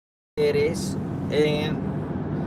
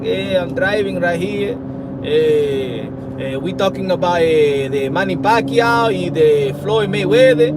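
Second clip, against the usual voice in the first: lightly put-on Spanish accent, louder at the end; mock Spanish accent; 4 dB louder than usual. Imitating a Spanish speaker for his viewers, light mock Spanish accent. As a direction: poke fun with a hint of a Spanish accent. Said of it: "The Spanish accent be like".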